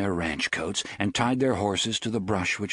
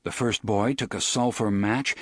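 ordinary talk, nothing marked

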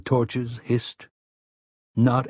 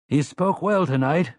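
foreman voice; 7 semitones higher than usual. A narrator's reading. Slightman speaks.

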